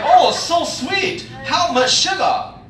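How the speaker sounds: high, slow, drawn-out customer voice; slow mock customer query; mimicked customer. Imitates suspicious customer.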